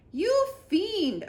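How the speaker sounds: high, loud accusing cry; cried out. A narrator's reading. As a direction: hurl the accusation at the villain. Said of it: "I cried".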